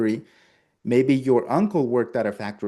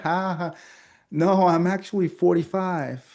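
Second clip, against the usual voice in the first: pitched-up laughing reply; amused client; 6 semitones higher than usual. Pretend. Client laughing off the salesman's guess, amused. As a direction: play the flattered customer.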